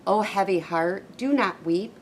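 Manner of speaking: plain reading voice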